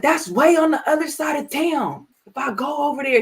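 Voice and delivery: high whiny complaining son voice; mock whiny son. Son griping to mom about the long drive, high and whiny. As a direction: whine like a put-upon son. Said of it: "he probably didn't say it like that".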